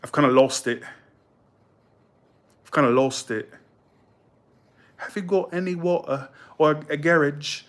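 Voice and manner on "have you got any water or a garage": half-hearted mock British, pitched up; a failing try at a British voice; British accent. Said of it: "I can't do that accent no more".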